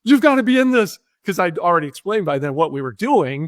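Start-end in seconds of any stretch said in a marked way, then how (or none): 0.0-1.0 higher and louder, eager insistence; excited director. Pretend. Director urging the cop to appear, eager and high-pitched. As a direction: pitch it up like a thrilled director begging. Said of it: "crazy, excited director".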